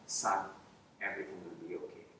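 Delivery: low, soft, calm fatherly promise; calm reassurance. Retold or imitated. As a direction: gently soothe a worried young man. Principal reassures the narrator.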